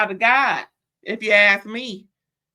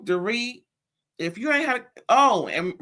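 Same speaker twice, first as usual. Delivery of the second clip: normal talking voice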